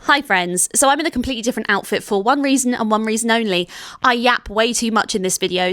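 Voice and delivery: ordinary talk, nothing marked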